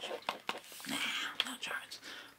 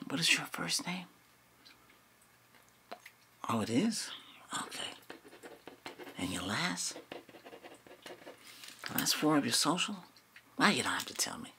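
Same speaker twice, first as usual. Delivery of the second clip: whispering; 4 dB quieter than usual. Acted. ASMR roleplay whispering.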